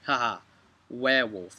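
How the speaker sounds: normal talking voice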